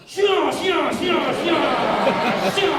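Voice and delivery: high shouted staccato syllables; nonsense retort. Retold or imitated. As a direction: shout it repeatedly straight in his face. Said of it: "looked him straight in the face and went".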